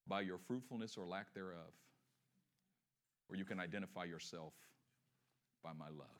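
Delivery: low, hushed pleading; tender appeal. Acted. Loving voice to Hannah offering a choice, tender hushed appeal.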